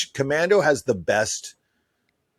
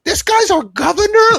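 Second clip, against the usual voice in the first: put-on very high, loud incredulous kid voice; mock disbelief in a high piping voice; confused kid; 9 dB louder than usual. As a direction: imitate a baffled boy. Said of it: "he's like".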